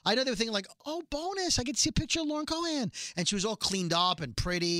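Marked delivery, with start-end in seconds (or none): 0.8-2.9 high, quick gushing imitation; sarcastically chirpy, much higher pitch; mock delight. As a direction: mimic a fan thrilled by a surprise treat. Mimicking what promoters imagined fans think.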